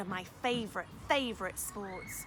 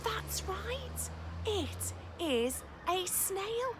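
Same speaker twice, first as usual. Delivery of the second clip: excited; 10 semitones higher, much slower than usual. Real, not acted. Praising children's answer.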